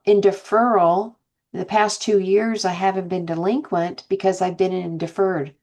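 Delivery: normal talking voice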